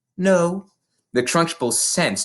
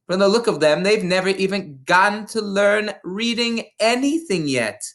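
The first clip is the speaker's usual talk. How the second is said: higher, contemptuous Trunchbull rant; bullying headmistress; 6 semitones higher than usual. A narrator's reading. Headmistress scorning the teacher and class, high and contemptuous. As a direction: sneer down at everyone in the room.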